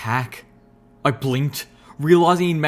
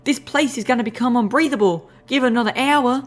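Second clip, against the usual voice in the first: high squeaky drawling critter; tiny woodland creature talking tough; 10 semitones higher than usual. Acted. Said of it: "the Warden critter voice".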